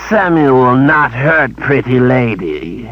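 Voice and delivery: slow, grumbled creature voice; grumbling creature. A narrator's reading. Creature servant denying the charge, slow sullen grumble. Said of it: "Samuel grumbled".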